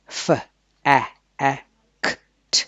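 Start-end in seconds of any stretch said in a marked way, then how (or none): none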